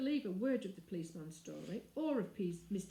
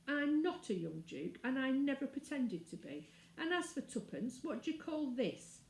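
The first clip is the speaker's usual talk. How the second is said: indignant, rising higher as he goes; a boy hotly defending himself; angry boy; 6 semitones higher than usual. Acted. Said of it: "and he was very angry".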